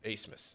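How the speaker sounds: Acemas said softly as ACE-mas; soft Acemas with a long A and an S sound; name said ACE-mas. Shown as a demonstration. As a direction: quietly repeat Acemas like "ace" plus "mas". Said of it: "that's how it's pronounced".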